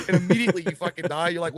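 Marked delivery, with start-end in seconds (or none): none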